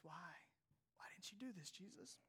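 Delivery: near-whispered, pained question; hushed doubting question. Acted. Preacher voices the doubters questioning Jesus, hushed. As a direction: ask it under the breath, barely voiced.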